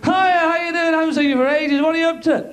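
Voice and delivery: high, overly friendly; chirpy phone caller. Retold or imitated. Lonely ex ringing old friend, high fake cheer. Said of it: "You're going".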